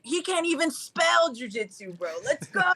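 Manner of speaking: high-pitched hype man trash talk; mock hype-man holler, rising on the last call; Johnny. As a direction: imitate a rowdy cornerman heckling the opponent. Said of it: "Johnny was like".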